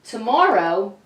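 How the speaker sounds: ordinary delivery, nothing marked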